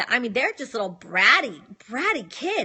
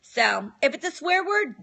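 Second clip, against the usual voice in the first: ordinary talk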